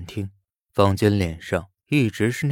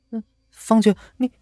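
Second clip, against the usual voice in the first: high, loud startled gasp of a name; 14 semitones higher, 5 dB louder than usual. A narrator's reading. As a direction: caught off guard, breaks off. Lin Wei startled seeing him.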